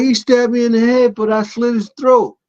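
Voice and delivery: mild mock tough-guy voice; imitating the security guy. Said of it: "He's like".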